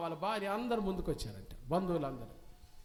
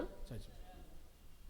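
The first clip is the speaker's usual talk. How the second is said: whispered; 3 semitones lower, 12 dB quieter, much slower than usual. Acted. Answer hushed to the listeners.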